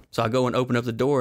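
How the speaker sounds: ordinary talk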